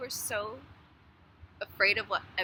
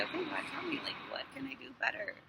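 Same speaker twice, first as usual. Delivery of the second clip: hushed prayer; 6 semitones higher, 7 dB quieter than usual. Retold or imitated. Quoting her own prayer.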